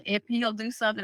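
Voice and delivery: ordinary delivery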